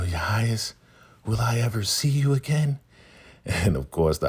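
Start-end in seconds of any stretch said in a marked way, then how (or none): 0.0-2.8 high, yearning little question; mock wistful plea. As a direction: plead in a raised, girlish-sweet lilt. Laura wistfully asks when parting.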